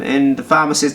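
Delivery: normal talking voice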